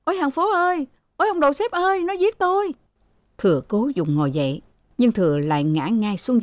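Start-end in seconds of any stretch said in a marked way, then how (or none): 0.0-2.7 very high-pitched wail; acted shrieking, without much volume; shrill cry for help. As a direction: wail to the neighbours in alarm. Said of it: "Cả ba đứa con gái cùng the thé".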